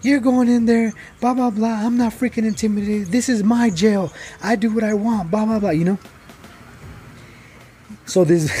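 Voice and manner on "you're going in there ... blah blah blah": higher, mocking voice of a bossy guard; imitating the female guard. Imitating the jail guard brushing off the inmates' warning. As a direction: play the guard as dismissive and smug. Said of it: "And she's like".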